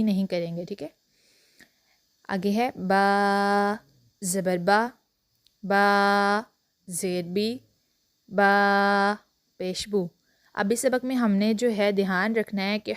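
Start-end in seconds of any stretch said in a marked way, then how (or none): 2.8-10.1 "बा" stretched long, then "ज़बर बा" short; drawn-out letter drill. Shown as a demonstration. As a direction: teach the takhti slowly, lengthening the letter name. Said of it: "हर लफ्ज़ को अलग अलग पढ़ेंगे". ("बा" लंबा, फिर हरकत छोटी)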